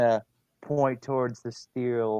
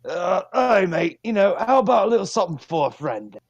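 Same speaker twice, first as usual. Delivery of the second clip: higher, louder conspiratorial bargaining; thieves' cant; 8 semitones higher, 9 dB louder than usual. Acted. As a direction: haggle in rogue's code, leaning in. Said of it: "in thieves' cant".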